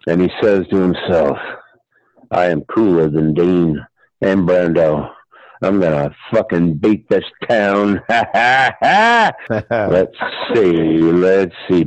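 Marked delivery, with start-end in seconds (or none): 2.3-8.0 low, cocky self-talk; mild low-voiced swagger; cool boast. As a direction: play it cool in a lower voice. Chris boasts to himself like Dean.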